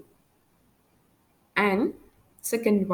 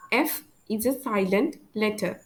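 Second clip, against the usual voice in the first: usual speaking voice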